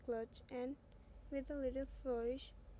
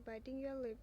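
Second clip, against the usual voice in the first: ordinary delivery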